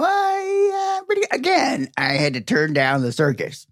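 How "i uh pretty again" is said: pitched way up and slow; high drawn-out voice. Acted.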